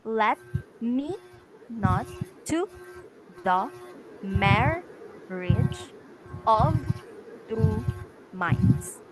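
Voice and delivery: word by word with clear pauses, "Let me not to the marriage of two minds"; iambic stress demo. Shown as a demonstration. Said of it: "you must make an emphasis".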